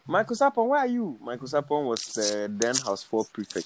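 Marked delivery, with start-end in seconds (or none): 0.1-1.2 calling out. Retold or imitated. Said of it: "he start the announce".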